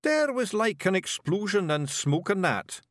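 high, slow Scots explanation; little Scots warrior reporting a disaster; Scottish Feegle. A narrator's reading. Voices Rob, a Feegle.

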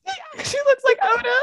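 high, laughing through words; crying with laughter; laughing hard. Real, not acted. Both cracking up laughing.

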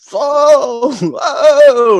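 loud, high, stretched-out wail; re-enacted cry under a heavy load; drawn-out cry. Re-enacting strain of heavy body.

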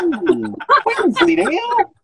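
lightly voiced cheese-loving Wallace; Wallace impression. Mocking the host, a light Wallace and Gromit voice. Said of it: "Like Wallace and Gromit".

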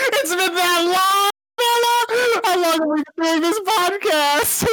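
very high, squealing astonishment; laughing disbelief. Real, not acted. Co-host stunned by how long it's been, high-pitched disbelief. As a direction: wail in amused shock.

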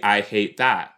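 normal talking voice

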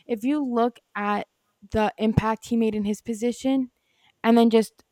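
usual speaking voice

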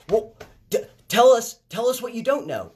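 high, loud mocking demand. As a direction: parody the pundits insisting they explain what they don't know. Mocking pundits demanding answers.

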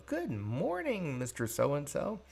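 sing-out good morning, lifted and rising at the end; smiling, needling greeting. Retold or imitated. Employee needles the boss each morning, smiling greeting. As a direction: greet him brightly just to bother him. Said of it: "sitting there and smiling and saying".

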